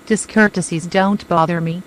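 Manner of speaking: plain reading voice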